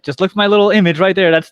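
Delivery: normal talking voice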